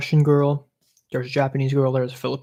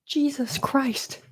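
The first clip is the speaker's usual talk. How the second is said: mock disbelief; 11 semitones higher, 4 dB quieter, much slower than usual. Mock disbelief at own friends.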